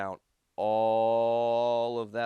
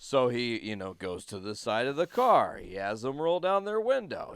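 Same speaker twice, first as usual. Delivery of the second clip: ordinary talk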